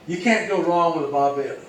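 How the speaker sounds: ordinary talk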